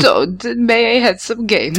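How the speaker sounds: high, slow little beggar voice; famished peasant. Acted. Co-host begging Todd for games, famished peasant. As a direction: plead like a starving little peasant. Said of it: "like, a little famished peasant".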